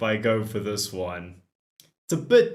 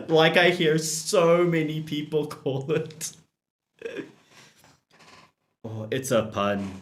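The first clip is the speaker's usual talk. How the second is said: usual speaking voice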